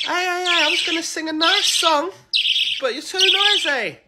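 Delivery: high, loud calling out; mock telling-off. Scolding birds too noisy to sing to, high mock complaint.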